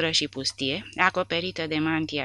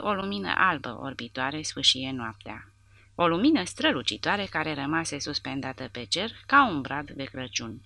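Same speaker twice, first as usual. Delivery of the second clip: usual speaking voice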